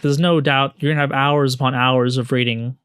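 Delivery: normal talking voice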